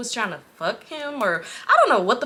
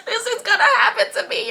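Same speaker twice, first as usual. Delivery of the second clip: very high, laughing squeal; mock panic; 13 semitones higher than usual. Storyteller imagining her own kidnapping, laughing mock panic.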